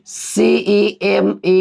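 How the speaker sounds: slow letter by letter सीईएमईएनटी; spelled out slowly. Shown as a demonstration. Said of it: "जैसे example आप ले लीजिए". (धीरे धीरे अक्षर बोलना)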